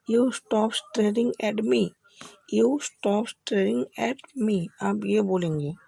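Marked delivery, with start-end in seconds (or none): 0.1-4.7 very slow, each word spelled out; slow teaching demo. Shown as a demonstration. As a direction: say it slowly for learners to copy. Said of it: "आप बोलेंगे". (धीमे-धीमे, साफ़ उच्चारण में)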